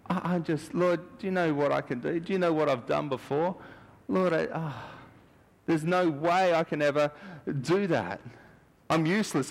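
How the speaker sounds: high, pleading protest; self-pitying whine. Retold or imitated. A believer protesting to the Lord that he is useless, whiny. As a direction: whine that you can't do it. People's complaint voiced to God.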